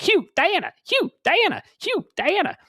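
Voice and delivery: put-on high, bouncy child's voice, chanting the names; excited little girl. S0 imitating the excited robot girl to friends, high childlike chant. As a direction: squeal it like a thrilled kid. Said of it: "she just gets all excited, and she's like".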